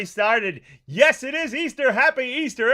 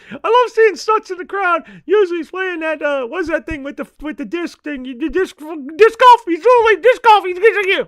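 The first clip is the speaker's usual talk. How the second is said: higher, louder goofy puppet character voice; a cheeky stoner puppet griping about the dog; Mister Rob puppet voice; 8 semitones higher, 6 dB louder than usual. Acted. Mister Rob the puppet complains to the host about the barking dog, in a higher, goofy puppet voice.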